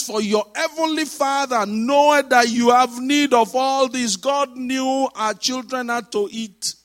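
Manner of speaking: high, emphatic pulpit delivery; forceful preaching. Acted. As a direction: declare the verse to the congregation.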